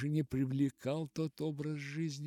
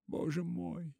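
narrated sob, pitched up; sobbing; 5 semitones higher than usual. A narrator's reading. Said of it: "всхлипнула она". (всхлипывая)

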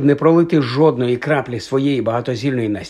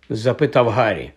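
ordinary delivery

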